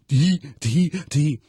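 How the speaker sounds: short repeated syllables in a comic character voice; Mister Tudball. Acted. Host showing how a comedy character talked, exaggerated mimicry. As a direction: imitate a funny little verbal tic. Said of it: "the one who talked like this".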